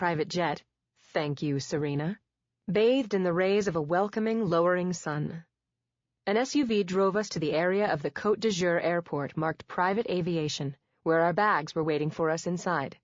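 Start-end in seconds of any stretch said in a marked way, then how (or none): none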